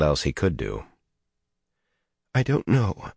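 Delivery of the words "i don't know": high, pained voice; agonized. A narrator's reading. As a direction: say it near tears. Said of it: "Min said in an agonized voice".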